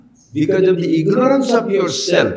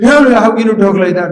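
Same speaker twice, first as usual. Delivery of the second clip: imitating an irritated comeback, raised voice; mock angry retort; 5 semitones higher, 7 dB louder than usual. Angry person snapping back at a remark, mock angry. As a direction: bristle back at the insult. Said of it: "you are in an angry mood and somebody says".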